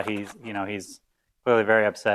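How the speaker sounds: ordinary talk, nothing marked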